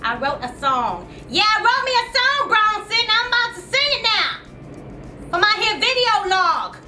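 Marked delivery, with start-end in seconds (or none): 1.3-6.8 high, raised-voice boast; defiant announcement. Acted. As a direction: call it out proudly at the husband. Brags to Bronson about her song.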